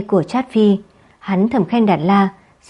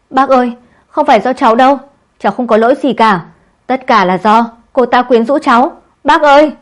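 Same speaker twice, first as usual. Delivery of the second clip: high, loud, fast begging; panicked nephew begs his uncle; frantic pleading; 4 semitones higher, 6 dB louder than usual. A narrator's reading. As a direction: beg for mercy in panic. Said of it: "Hắn không ngừng khóc giống lên, rồi cầu xin tha thứ".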